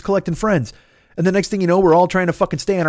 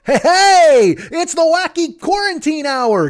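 mock cheesy host, much higher and louder at the start; wacky show host; 10 semitones higher, 4 dB louder, slower than usual. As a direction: ham up a goofy kids' show host. Mocking a wacky quarantine show host.

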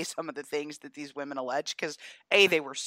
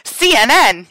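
loud, higher-pitched goading shout; teasing shout; 5 semitones higher, 15 dB louder than usual. Pretend. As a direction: belt it out to provoke a mock freak-out. Deliberately triggers the announced freak-out.

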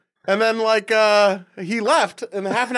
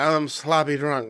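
put-on sloppy, slurring drunk voice; overdone drunk voice; 6 semitones lower, slower than usual. Shown as a demonstration. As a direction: ham up the drunk act. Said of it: "some people go over the top with it".

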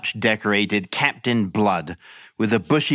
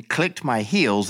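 plain reading voice

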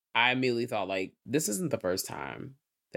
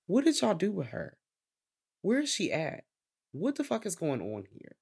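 mock suspicious demand; 7 semitones higher than usual. Podcaster imitating Ricky asking where she is, high mock suspicion. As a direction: question them pointedly, starting pitched up.

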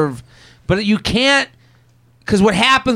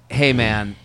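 calm, gentle ask; 7 semitones lower, 7 dB quieter than usual. Retold or imitated. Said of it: "you have to be like".

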